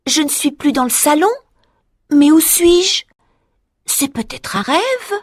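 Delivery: voix aiguë, incrédule; découvre un lieu inconnu; étonnée. Acted. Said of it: "s'écrie". (Marie qui s'écrie, stupéfaite)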